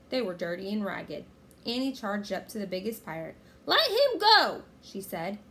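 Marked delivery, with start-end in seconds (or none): 3.7-4.6 high, loud defiant yell; fierce kid's shout; angry demand. A narrator's reading. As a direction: demand his release at full voice. Annie attacking the pirate.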